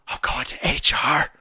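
whispered threat. Pretend. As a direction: breathe it as a petty threat. Woman threatens.

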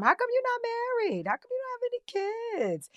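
high-pitched mock nosy questioning; nosy questioner. People prying about marriage and kids, mock nosy high voice.

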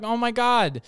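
high-pitched mimicked delight; mock-gushing astonishment; happily amazed. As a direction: imitate a thrilled onlooker. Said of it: "they're so happy".

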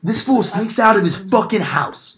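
high, slow indignant rant. Real, not acted. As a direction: rant it in disbelief. Ranting about Polar Express kid.

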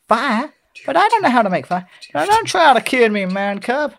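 high, urgent cartoon voices; high, hammed-up animated dialogue; acted film dialogue. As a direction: pipe up in protest, then press insistently. Acting both sides of film dialogue.

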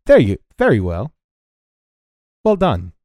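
crisp praise, then dismiss the pupil; accented training instructor. Acted. Game master voices the instructor.

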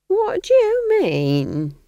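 high, drawn-out, loud affronted question. Acted. Nanny challenges Granny's accusation, affronted.

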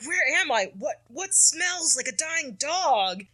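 a hint of a high, bewildered Peter, slow on the second line; lightly voiced confused hero sniffing the air. Peter waking in Wolverine's body.

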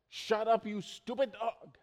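high, loud snap at the barking dog; yelling at the dog. Retold or imitated. Father yelling at the barking family dog, high and sharp. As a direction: bark it at the yapping dog. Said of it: "And I said".

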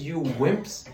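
lightly voiced scornful cat; a hint of a smug cat sneering; cat scoffing at bundled humans, laughing; cat's mockery. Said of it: "looks at you and says".

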